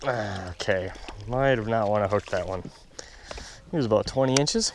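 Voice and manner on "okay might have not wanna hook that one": ordinary delivery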